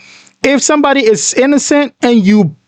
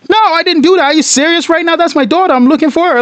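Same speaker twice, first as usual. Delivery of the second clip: higher, mild pretend outrage; hammed-up hurt denial from the accused father; mock indignant innocent; 7 semitones higher, faster than usual. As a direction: show how an innocent dad would protest. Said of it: "act, bro".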